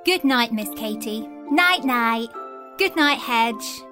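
usual speaking voice

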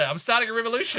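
high, rallying cry; rally the troops; excited declaration. Real, not acted. Declaring a revolution, high and far.

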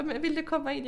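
high-pitched gentle offer; inviting grandmother. Retold or imitated. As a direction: imitate a grandmother's kindly invitation. Voices grandmother inviting her in. (härmad farmor som bjuder in)

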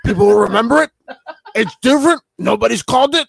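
Vince McMahon. Retold or imitated. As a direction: imitate a stubborn company boss. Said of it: "you know what Vince said".

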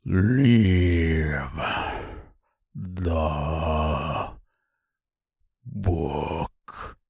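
low, slow, fading ogre threat; deep monster voice, trailing off; menacing ogre. Acted.

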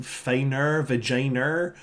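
"finer, vagina" drawn out with an R ending each. Shown as a demonstration. Showing how the singer rhymes "finer, vagina", R on the end. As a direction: slowly end both "finer" and "vagina" on a clear R. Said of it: "he puts the R on the end".

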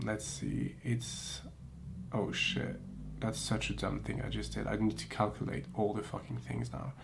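hushed so the sleeping dog stays asleep; quiet at night. Real, not acted. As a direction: keep it low and whispery. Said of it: "my voice is getting low and lower because".

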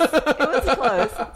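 drawn out through laughter; laughing. Real, not acted. Repeating her claim through a slow laugh. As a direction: stretch it slowly while laughing.

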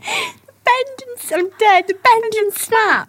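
high squeaky giggling through the words; cracking up mid-phrase; laughing. Real, not acted. Friend laughing helplessly at the bend and snap.

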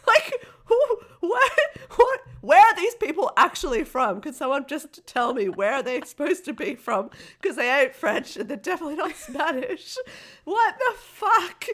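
high-pitched exasperated bewilderment; worked-up, squealing-high confusion; baffled outrage. Retold or imitated. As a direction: demand answers in comic disbelief. Retold bafflement at the accents.

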